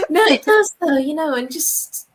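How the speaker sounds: high and loud, laughing it through; high, loud amused protest; laughing insistence. Real, not acted.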